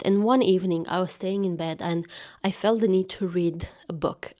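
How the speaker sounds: hushed whisper, like in bed. Real, not acted. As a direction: whisper it like a secret. Whispers the bedtime anecdote.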